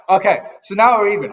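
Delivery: real laughter after a lucky pull; laughing. Real, not acted. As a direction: laugh it out, pleasantly surprised.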